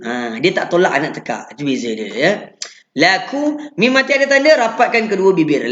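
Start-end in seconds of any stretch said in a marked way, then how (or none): none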